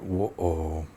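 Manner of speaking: low, quiet, breathless whoa; stunned whoa. Retold or imitated. A kid caught shoplifting, to the guard: a low, stunned whoa. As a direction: let it slip out under your breath, caught.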